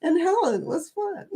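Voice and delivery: laughing quote. Retold or imitated. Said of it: "You were like".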